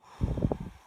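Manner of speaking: loud, long breathy exhale; deep exhale. Shown as a demonstration. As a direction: breathe out fully for the group. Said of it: "Take a deep breath".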